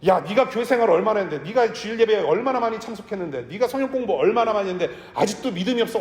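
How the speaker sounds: mock furious Jesus; mock angry scolding. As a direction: scold them like an annoyed elder, half laughing. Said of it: "우리 주님께서 화가 나셔가지고".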